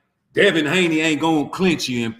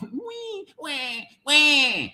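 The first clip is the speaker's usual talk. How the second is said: mock crying wail; 7 semitones higher, much slower than usual. Mocking a fighter who cried, with a fake baby wail. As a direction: wail like a crybaby to mock him. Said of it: "Crying like a bitch".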